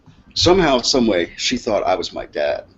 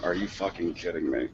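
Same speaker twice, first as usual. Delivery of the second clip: much softer, lower aside; hushed, exasperated disbelief; retold disbelief; 4 semitones lower, 12 dB quieter than usual. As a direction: grumble it low, as if to yourself. Own disbelief at long mass, retold.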